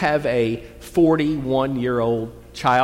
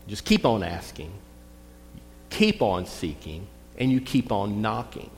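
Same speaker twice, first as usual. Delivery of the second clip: quieter and lower, dwelling on each keep; hushed, deliberate urging; soft, low repetition; 4 semitones lower, 6 dB quieter than usual. Shown as a demonstration. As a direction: draw them in softly, insistent. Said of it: "That's what the words mean there".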